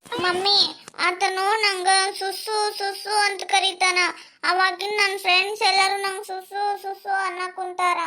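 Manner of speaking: put-on tattling child, sing-song at the end; little girl voice. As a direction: complain to mommy like a hurt little girl. Little daughter complains to mother.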